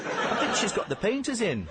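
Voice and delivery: high, boyish cartoon train voice; chirpy little train apologising; Stevie the steam train.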